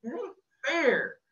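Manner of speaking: high, slow, aching protest; boy crying out passionately about hard lives. A narrator's reading. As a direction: cry out against the injustice. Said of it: "I cried passionately".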